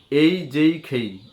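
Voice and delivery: এই যেই খেই said slow and clear; slow drill. Shown as a demonstration. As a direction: spell out এই যেই খেই slowly. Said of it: "এই তিনটা আপনি উচ্চারণ করবেন". (ধীরে এই যেই খেই)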